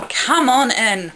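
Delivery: loud, slow, high-pitched invitation; grinning welcome. Acted. Captain Jack inviting her into the police box, grinning. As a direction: welcome them in with a big grin. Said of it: "he said with a big grin".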